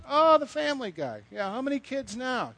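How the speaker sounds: high, sing-song colleague's tease; mock teasing. Colleagues greet the known family guy, mock teasing. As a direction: imitate amused colleagues, pitched well up.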